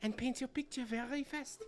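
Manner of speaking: put-on high, girlish, anxious noblewoman; young lady voice. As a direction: ask about papa like a worried girl.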